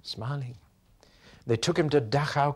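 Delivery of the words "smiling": soft echo. Shown as a demonstration. Preacher echoing how the prisoner left, softly. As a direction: repeat the word smiling much softer. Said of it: "And he went away smiling".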